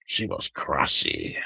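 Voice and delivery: put-on "crassy" with a deep, slow French accent. Mocking how a French person says "crassy". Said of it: "that's how a French person says crazy".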